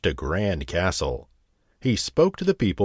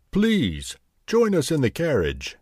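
gracious king voice; 7 semitones higher than usual. Acted. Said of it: "said the king".

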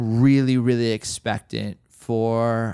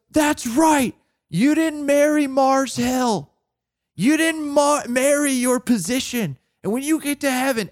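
mentor's blunt rebuke; 12 semitones higher than usual. Retold or imitated. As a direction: lecture the young pastor like a frank elder. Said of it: "kinda New York ways".

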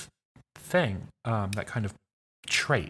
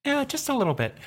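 pitched-up character voice. Shown as a demonstration. Player showing how Siobhan's voice goes up, pitched high. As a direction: voice lifted much higher, falling at the end. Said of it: "You, like, go up a half pitch".

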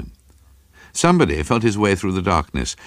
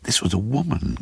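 higher, impatient correction; 4 semitones higher, 3 dB quieter than usual. A narrator's reading. Craven correcting his neighbour about the victim, impatient. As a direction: correct him with restrained impatience. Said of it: "Craven said impatiently".

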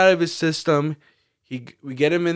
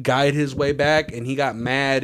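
usual speaking voice

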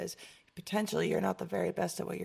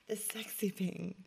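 sexy voice; slower than usual. Retold or imitated. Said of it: "I'm like".

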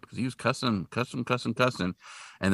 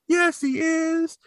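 very high, slow mock whine; drawn-out squeaky kid voice; crying little boy; much slower than usual. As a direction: insist like a wailing small child. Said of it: "and he's crying".

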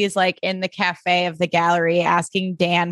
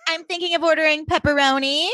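imitating Vanessa; 8 semitones higher than usual. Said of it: "being like".

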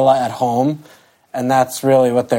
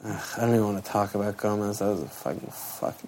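fed-up groan, fading to a mutter; annoyed ugh; 3 semitones lower, 10 dB quieter, faster than usual. Real, not acted. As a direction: trail off in disgust. Groaning about the goalkeeper Gomez.

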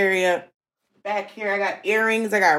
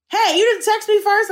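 indignant caller; 13 semitones higher, 4 dB louder than usual. Retold or imitated. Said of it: "they've been like".

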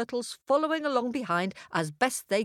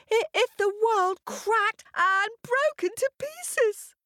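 much higher, stuttering little hare; nervous stammer; 12 semitones higher than usual. A narrator's reading. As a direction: stumble over words, scared of the lion. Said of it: "The little hare was very nervous".

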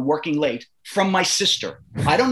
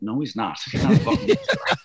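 laughing disbelief; 3 semitones lower, faster than usual. Retold or imitated. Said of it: "looking at each other like".

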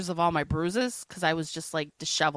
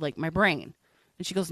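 ordinary talk, nothing marked

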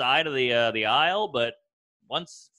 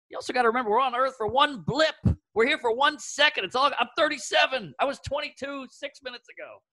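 high, urgent, worked-up plea; incredulous, pitched-up exasperation; exasperated rant; 8 semitones higher than usual. Real, not acted. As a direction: rant like time is running out. Exasperated rant about short life.

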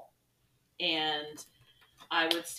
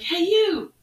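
much higher and louder, sing-song; calling out cheerfully; 13 semitones higher, 9 dB louder, slower than usual. Retold or imitated. Teacher calling to a passing student, loud and cheery. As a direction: call out friendly praise. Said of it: "I'm like".

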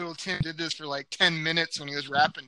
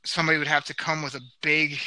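normal talking voice

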